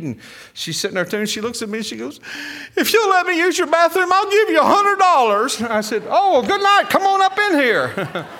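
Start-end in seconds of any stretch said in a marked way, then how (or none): 2.8-5.6 very high, loud tearful wail; mock crying. Crying woman begging to use the couple's bathroom, hammed-up bawling. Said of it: "she's waving and she's crying". 6.1-8.3 high, loud mock-eager whoop; eager welcome. Preacher inviting the woman into the motorhome, comically eager holler.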